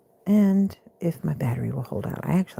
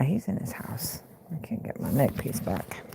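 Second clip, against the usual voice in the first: whisper; 4 semitones lower, 9 dB quieter than usual. Real, not acted.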